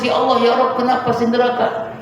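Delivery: high, indignant cry to God; high-pitched protest. Acted. Martyr protesting to God about hell, indignant and high. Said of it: "dia protes".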